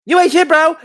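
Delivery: high, loud, put-on voice of an angry fan; squeaky mock insult from a hater. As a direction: sneer it like a keyboard troll fan. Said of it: "Imagine the motherfuckers".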